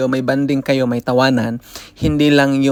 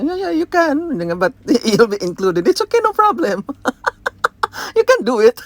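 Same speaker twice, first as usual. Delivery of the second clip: imitating coworker; 8 semitones higher than usual. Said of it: "Sabi niya".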